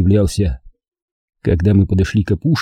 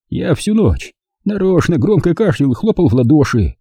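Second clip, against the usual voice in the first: put-on higher, worn old man's voice; 8 semitones higher than usual. A narrator's reading. Captured old man explains himself to partisans, higher old voice. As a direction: play the frightened old German.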